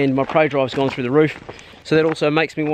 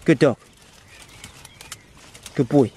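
ordinary talk

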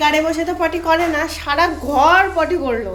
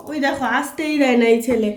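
usual speaking voice

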